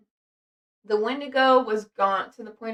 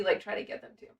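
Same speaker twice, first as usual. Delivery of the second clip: whispered; 4 semitones lower, 9 dB quieter, faster than usual. Retold or imitated. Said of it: "He was like".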